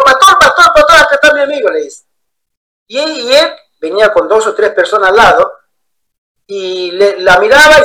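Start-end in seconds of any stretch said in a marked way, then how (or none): 0.0-1.7 high, raised call across a room. Retold or imitated. Friend calling the pastor from the back row, high call. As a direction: call to him from far back.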